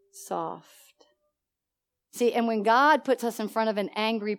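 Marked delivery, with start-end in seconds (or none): 0.1-0.7 low, much softer single word. Shown as a demonstration. Preacher telling how the hard daughter turned, soft. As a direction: drop the voice right down. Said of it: "She then turned what?".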